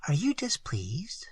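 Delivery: soft whispered question. A narrator's reading. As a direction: whisper it timidly. Timid question after murmur.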